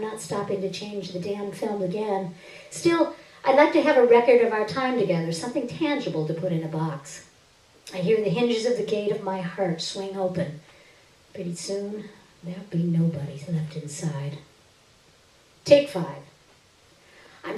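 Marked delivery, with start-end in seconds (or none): none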